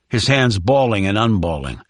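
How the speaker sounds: plain reading voice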